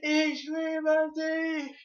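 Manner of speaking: very high, long-held lovesick scream; screaming in German. Retold or imitated. Screaming in German at strangers walking away, high and drawn-out. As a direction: belt out the German, stretching every vowel. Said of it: "I was screaming in German".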